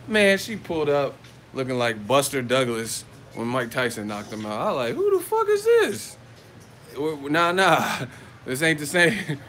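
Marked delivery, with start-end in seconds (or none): 4.9-6.2 acted shock. Retold shock at driver's looks.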